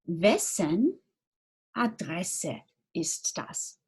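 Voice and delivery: slow, careful, word by word; "wessen Adresse ist das" drawn out with each word apart; slow model question. Shown as a demonstration. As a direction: spell it out slowly for learners. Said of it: "unsere Frage wäre".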